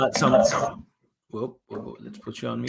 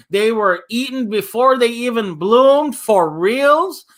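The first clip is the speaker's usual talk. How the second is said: higher, slower, disbelieving; dismayed surprise; 8 semitones higher, 5 dB louder, slower than usual. Acted. Teacher voicing Gavin upset about eaten flowers, mild dismayed surprise. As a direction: stretch it out in shocked disbelief. Gavin hears the bunny ate shoots.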